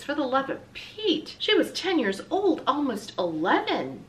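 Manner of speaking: high-pitched indignant protest; a child's acted indignation; outraged. A narrator's reading. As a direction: protest in offended disbelief, slowing at the end. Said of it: "She felt a flush of outrage".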